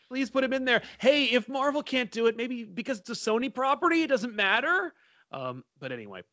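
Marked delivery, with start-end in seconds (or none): 0.1-4.9 high, pleading put-on voice; pleading impression. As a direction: beg in a raised, whiny voice. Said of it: "I love doing that impression".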